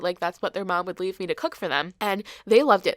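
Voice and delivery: usual speaking voice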